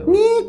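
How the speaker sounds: short, very high-pitched squeak; shrill squeal. Retold or imitated. Imitating the wife's wordless scream from the bedroom, shrill squeal. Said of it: "cuma ngelengking tinggi".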